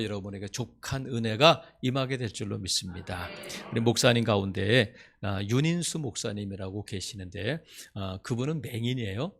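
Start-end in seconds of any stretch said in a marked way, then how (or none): none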